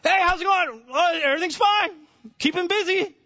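high, put-on churchgoer chat; falsely cheery small talk. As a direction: act out breezy greetings in a high voice.

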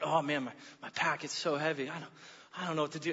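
low, soft, worn-out mock whine; whining complaint. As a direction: grumble wearily, imitating a tired hiker. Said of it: "hearing me whine and complain".

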